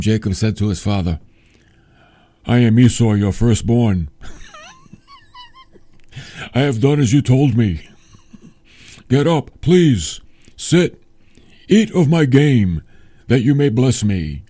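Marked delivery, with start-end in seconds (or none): 2.4-14.4 hammed-up impostor, far from own voice; put-on Esau voice. Jacob posing as Esau to his blind father, hammed-up fake voice. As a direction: put on a forceful, stilted fake voice, word by word. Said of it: "You think he talked like that?".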